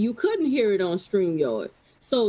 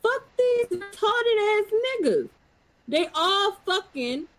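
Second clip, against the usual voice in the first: heated dismissal; 7 semitones higher than usual. Real, not acted. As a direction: stretch it out high, thoroughly fed up. Heated rant dismissing rival group.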